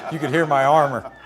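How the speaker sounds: ordinary talk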